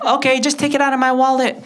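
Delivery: high-pitched mom voice; indulgent mother. Acted. Mother handing her son money, indulgent. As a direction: give in at once in a high mom voice.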